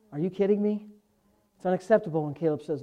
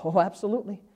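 ordinary delivery

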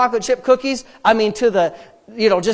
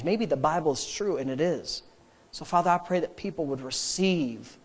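low, softer earnest prayer; closing prayer; 4 semitones lower, 4 dB quieter than usual. Real, not acted. Pastor praying for congregation, earnest prayer. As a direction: pray sincerely, unhurried between phrases.

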